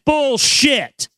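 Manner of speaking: an angry customer raising his voice at a clerk. Retold or imitated. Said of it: "I raised my voice".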